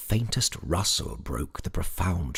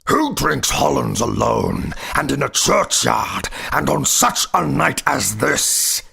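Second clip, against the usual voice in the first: high, very loud goblin voice; accusing goblin; 8 semitones higher, 10 dB louder than usual. A narrator's reading. Goblin challenges the terrified sexton, loud and accusing. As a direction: cry each phrase out in accusation.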